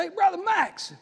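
preacher calling to a man at the back; hollered greeting. Retold or imitated. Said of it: "I hollered back there".

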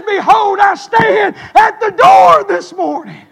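loud, high, slow call of the verse; shouted preaching. Acted. Preacher to congregation, booming the verse. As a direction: proclaim it like thunder. Shouted scripture then quiet repeat.

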